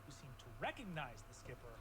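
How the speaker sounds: low, measured storytelling; game master recap. Acted. GM recaps open questions.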